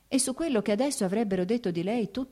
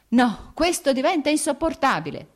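raised voice, high and loud; grido esasperato; 9 semitones higher, 6 dB louder than usual. A narrator's reading. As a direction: cry out at the end of your patience. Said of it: "gridò Vronsky alzandosi dalla sedia". (gridato, esasperato)

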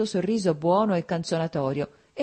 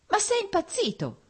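indignant woman's outburst, pitched way up; vexed cry. A narrator's reading. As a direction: flare up with irritation. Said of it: "gridò lei avvampando di stizza".

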